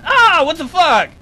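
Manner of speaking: loud startled outburst; surprised curse. Real, not acted. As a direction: blurt it out, caught off guard. Caught while gaming.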